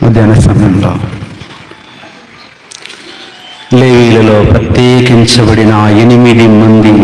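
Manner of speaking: prayer-time exhortation. Acted. Leads congregation into prayer. (తక్కువ స్వరంలో ప్రార్థనాపూర్వకంగా)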